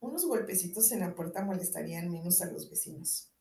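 soft, sleepy grumble from indoors; drowsy voice. A narrator's reading. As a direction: speak quietly as if just woken. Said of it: "Una voz adormecida dijo desde el interior".

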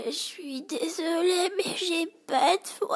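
high, slow, strained croak with no voice behind it; voice gone. Retold or imitated. Customer with no voice apologizing to the shop assistant, in a high strained croak. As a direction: rasp it out like a woman who has lost her voice. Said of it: "elle fait".

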